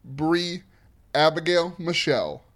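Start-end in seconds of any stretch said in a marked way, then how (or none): none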